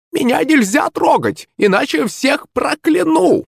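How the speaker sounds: high, slow crone threat; old witch voice. Acted. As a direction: croak a curse like a hag.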